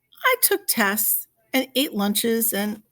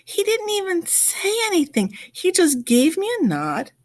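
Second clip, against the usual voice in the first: usual speaking voice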